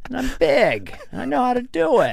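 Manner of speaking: Biden impression. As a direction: do Joe Biden pitching a pickup line. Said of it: "But Biden be like".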